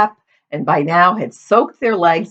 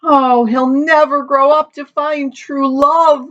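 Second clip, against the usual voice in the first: moaning; 10 semitones higher than usual. A narrator's reading. Mother mourns her imagined grandson, moaning. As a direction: moan it in grief, pitched high. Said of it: "moaned the mother".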